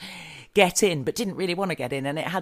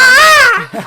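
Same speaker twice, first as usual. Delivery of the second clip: high shrill peacock screech; peacock call; 18 dB louder than usual. Pretend. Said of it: "my impression of a peacock".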